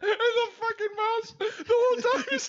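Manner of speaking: high squealing words, weeping with laughter; crying with laughter. Real, not acted. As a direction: laugh till you cry through the line.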